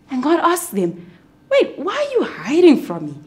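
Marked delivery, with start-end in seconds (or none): 1.5-3.1 a higher, raised questioning voice; God, mildly puzzled. Acted. God asks Adam and Eve why they hide.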